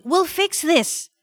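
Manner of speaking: put-on high, slow, insistent child; pleading little boy. A narrator's reading. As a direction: promise it earnestly and slowly. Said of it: "Noel pleaded".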